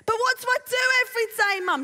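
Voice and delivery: high, fast Essex daughter; Essex accent. Acted. Rose complaining to her mum, Essex accent. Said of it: "I'm gonna do my Essex accent".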